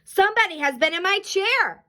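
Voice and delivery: high-pitched, forceful bear outcry; storybook papa bear's big outcry; great big Papa Bear voice. A narrator's reading. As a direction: boom it out like an indignant big bear. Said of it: "in his great big voice".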